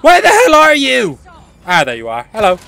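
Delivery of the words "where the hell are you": raised, high-pitched demand; exasperated call. Real, not acted. Player hunting a hidden enemy, exasperated call.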